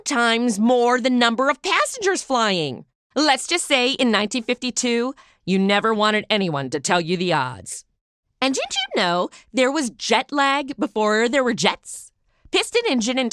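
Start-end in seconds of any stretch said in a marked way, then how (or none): none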